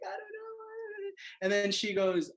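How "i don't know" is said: tearful mumble. Retold or imitated. Crying teen answers mom.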